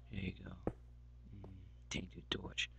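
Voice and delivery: hushed, breathy whisper to the torch; barely-there whisper, secretive. Real, not acted. Gamer whispers to a tainted torch, hushed.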